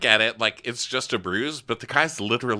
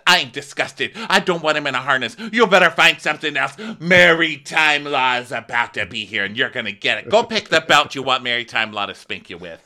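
mock furious rant; 6 semitones higher, 9 dB louder than usual. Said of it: "She's like".

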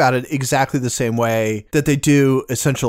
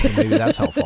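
laughing; 14 semitones higher than usual. Real, not acted. Laughing joke aside.

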